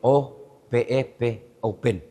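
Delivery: slow, soft spelling of P E P, then open said with a p like open, not oven. Retold or imitated. Villager at the mall reading the sign aloud letter by letter. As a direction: sound it out letter by letter, slightly puzzled. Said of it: "dia tiba-tiba dia eja".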